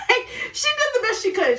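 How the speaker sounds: high, laughing through the words. Real, not acted. To viewers about the friend who left, laughing. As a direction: giggle it out, can't keep a straight face.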